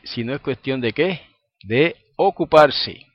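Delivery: preacher teasing out the answer; preacher's question and answer. Shown as a demonstration. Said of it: "call and answer to congregation".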